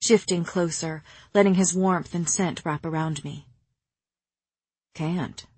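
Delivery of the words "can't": quiet admission. A narrator's reading. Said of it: "he admitted".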